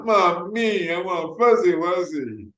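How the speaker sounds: put-on high little kid demanding a toy; whiny child. Child insisting to mommy that he wants Fuzzy Wuzzy, high whine. Child voiced in supermarket story.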